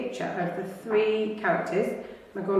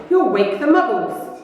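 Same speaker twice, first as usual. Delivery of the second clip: stern scolding witch; 5 semitones higher, 5 dB louder than usual. Acted. McGonagall scolding.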